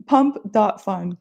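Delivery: slow, spelled-out emphasis; slow and clear. Shown as a demonstration. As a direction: say each word slowly and clearly. Spelling out the site name.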